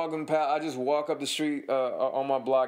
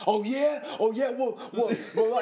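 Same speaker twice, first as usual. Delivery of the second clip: high-pitched sputtering comeback; flustered retold anger; 10 semitones higher than usual. Dog owner called a coward by the homeowner, high flustered bluster. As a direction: imitate a riled man stuck for words, voice jumping up. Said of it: "he just gets fucking red in the face".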